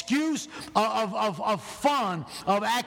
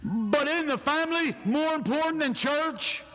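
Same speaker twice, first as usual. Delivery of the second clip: mock angry relatives; 6 semitones higher than usual. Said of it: "they used to get mad".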